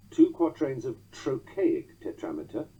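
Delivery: ordinary delivery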